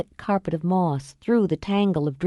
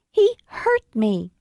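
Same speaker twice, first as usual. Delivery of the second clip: high, slow, fake-tearful plea; milk the tears, slowly; slow fake sob; 4 semitones higher, slower than usual. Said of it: "Tears slid gracefully down her cheeks".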